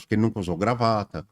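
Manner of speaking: usual speaking voice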